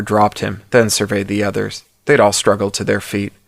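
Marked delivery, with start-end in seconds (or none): none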